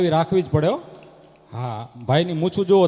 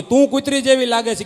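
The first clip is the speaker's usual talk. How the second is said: higher, louder quarrel shout; quarrel yell; 5 semitones higher, 7 dB louder than usual. Retold or imitated. Husband yelling insult at wife, loud quarrel. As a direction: hurl the insult back.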